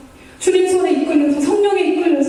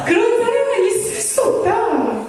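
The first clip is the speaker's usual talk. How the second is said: high, drawn-out mock wailing; hammed-up tearful insistence; imitated sobbing protest; 7 semitones higher, slower than usual. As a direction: wail the denial, rising higher as it goes. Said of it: "이러면서 막 우는 거예요".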